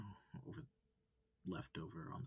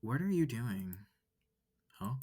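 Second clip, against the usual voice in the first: louder, rising alarm; loud alarmed questions; 4 semitones higher, 8 dB louder than usual. Acted. Kidnapped student demanding answers from the wolf, alarmed. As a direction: demand answers, getting upset.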